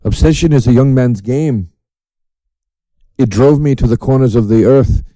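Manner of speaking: a hint of odd Austrian accent, measured. Comedian quoting [name] from The Prestige, lightly Austrian-accented. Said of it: "he has that weird accent".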